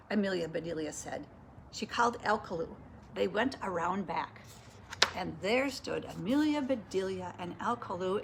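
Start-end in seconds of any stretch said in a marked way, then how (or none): none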